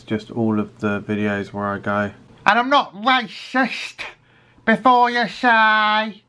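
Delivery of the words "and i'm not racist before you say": much higher, slow put-on catchphrase delivery. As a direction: say the catchphrase in a high silly character voice. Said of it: "which is a catchphrase of sorts".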